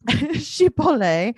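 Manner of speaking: Chipotle very slow and high, "shi-po-LAY"; amused mimicry, stretching Chipotle into shi-po-lay; imitated mispronunciation. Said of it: "She pronounces things very funny".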